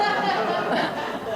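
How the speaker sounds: short real burst of laughter; real amused chuckle. Real, not acted. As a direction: laugh at your own joke. Laughs at own joke.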